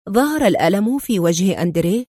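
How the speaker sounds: ordinary delivery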